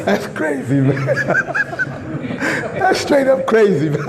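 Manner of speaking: laughing in disbelief. Real, not acted. Preacher mocking halal friendly, laughing. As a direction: laugh through the words.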